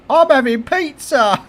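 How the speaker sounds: put-on high bright teen announcement; daughter announcing. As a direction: call it out like a cheeky daughter. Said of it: "goes to me".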